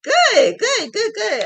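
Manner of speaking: high, loud happy "good"s; excited. Real, not acted. Praising a viewer's comment, excited and bright. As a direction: cheer the viewer on, delighted.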